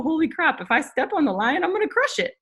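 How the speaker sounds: high, amazed imitation of the elephant with a hint of a laugh; surprised elephant realising. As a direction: play the elephant suddenly realising it could crush the lion, pitch jumping up.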